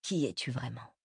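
low, soft inner voice; unspoken question. A narrator's reading. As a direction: wonder it privately, voice dropped. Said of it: "avait-il envie de lui demander". (question intérieure, grave et feutrée)

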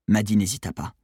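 plain reading voice

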